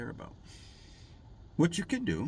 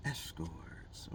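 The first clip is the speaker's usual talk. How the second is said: soft repeat-after-me; 3 semitones lower, 11 dB quieter than usual. Shown as a demonstration. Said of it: "Repeat after me".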